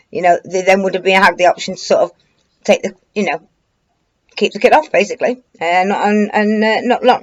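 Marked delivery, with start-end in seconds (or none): none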